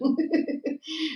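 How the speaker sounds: sudden high-pitched cackle; a strange squealing laugh; odd high laugh. Real, not acted. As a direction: laugh that the other speaker scolds. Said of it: "don't really laugh like that".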